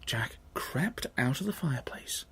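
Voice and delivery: unvoiced hushed telling; whispered narration. A narrator's reading. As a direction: whisper as Jack sneaks. Sneaking narration.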